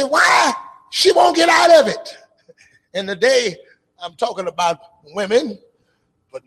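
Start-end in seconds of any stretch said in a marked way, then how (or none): 0.0-2.2 mocking outsider. Mocking what outsiders ask.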